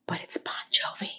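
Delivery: breathy stage whisper aside. Acted. Host whispering the song name to viewers. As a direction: whisper it like a secret.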